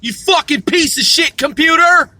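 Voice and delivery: loud, high-pitched cursing at the computer; angry yelling. Real, not acted. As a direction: shout down the machine.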